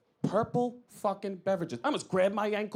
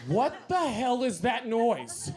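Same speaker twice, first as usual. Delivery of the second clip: slow outraged; 2 semitones higher, slower than usual. Acted. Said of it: "this is what you would hear".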